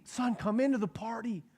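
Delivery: a father pleading with his sulking son. Acted. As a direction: beg your son to come in, gently.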